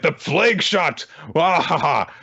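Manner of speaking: high, hammed-up villain call with a mock laugh; villain's attack cry. Warden fires his elephant gun at the crowd, gloating. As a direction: crow the attack like a cartoon villain.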